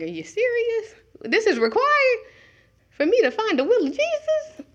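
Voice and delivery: high, half-laughing incredulity; mock disbelief. Preacher mocking the congregation's shock: high laughing disbelief. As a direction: act stunned at the idea, laughing.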